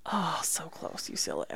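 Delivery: breathy whispered aside. Real, not acted. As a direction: hush it out under the breath. Mock dismay at rival pick.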